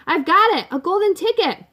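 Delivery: high, loud triumphant cry; excited yell. A narrator's reading. Woman worker yells to the boss that she found it, elated. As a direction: yell the find across the factory. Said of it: "one of my women workers yelled".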